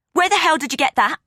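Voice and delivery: high, loud demanding snap; angry snap. A narrator's reading. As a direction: rip into her for it. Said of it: "Gail snapped".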